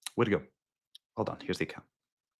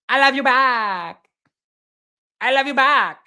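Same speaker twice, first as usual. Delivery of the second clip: put-on slow, light Mexican Montreal accent parody; mock Mexican Montreal accent; 5 semitones higher than usual. Host doing a joking Mexican Montreal accent. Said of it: "Mexican Montreal accent".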